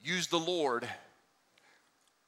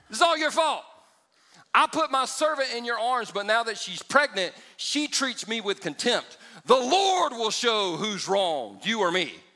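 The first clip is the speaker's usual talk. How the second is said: yelling; 5 semitones higher, 4 dB louder than usual. Acted. Sarah yells at Abram about servant, high angry shout. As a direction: scold him and call on the Lord. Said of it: "she's yelling".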